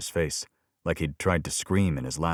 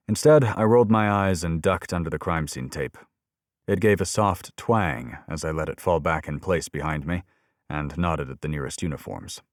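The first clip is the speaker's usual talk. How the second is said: ordinary delivery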